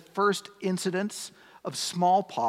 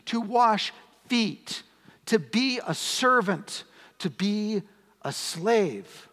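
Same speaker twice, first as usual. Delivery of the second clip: slow, emphatic, higher preacher's voice; fervent preaching; 4 semitones higher, slower than usual. Acted. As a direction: drive home each phrase of service. Preacher stresses serving, rising intensity.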